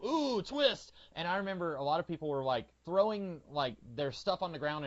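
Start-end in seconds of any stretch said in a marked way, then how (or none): 0.1-0.9 mock surprise. Mock reveal of a twist.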